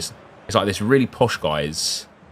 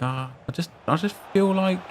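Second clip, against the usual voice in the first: mock exasperated; 11 semitones higher, 3 dB quieter than usual. Imitating himself, exasperated with worker.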